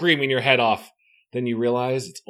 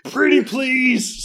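wheedling creature; 12 semitones higher, 5 dB louder, much slower than usual. Acted. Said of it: "Spy Eye's voice grows softer, gentler".

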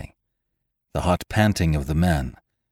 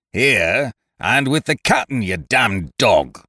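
loud, higher, scornful roar, slower on the insult; 6 semitones higher, 4 dB louder than usual. A narrator's reading. Bosun on deck roaring scorn at the castaways. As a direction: bark the insult down at the shore. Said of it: "roared back Bose".

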